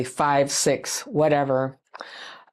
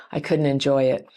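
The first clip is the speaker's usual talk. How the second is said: usual speaking voice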